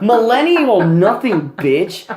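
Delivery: retold comeback with some heat; mock angry retort. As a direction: fire it back like a retold insult. Retold shouting match at Subway.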